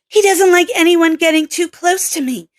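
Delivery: corrected take in the female lead's voice; Kelly's voice. Acted. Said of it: "that is Kelly's line!".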